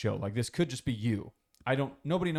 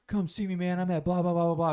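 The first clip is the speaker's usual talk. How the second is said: high, mocking tough-guy taunt; mock a guy talking big online; trash talker; 7 semitones higher, 3 dB louder than usual. Friends imitating online trash talkers, high and mocking. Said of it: "they're like".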